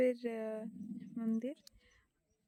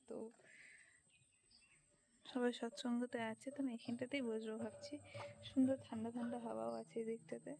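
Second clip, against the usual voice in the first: speaking softly; 9 dB quieter than usual. Real, not acted. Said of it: "আস্তে আস্তে কথা বলছি".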